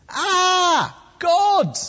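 loud, very high mock scream; terrified. Gideon seeing the angel's fire, terrified. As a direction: shriek it in hammed-up terror. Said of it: "He's terrified".

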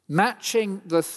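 usual speaking voice

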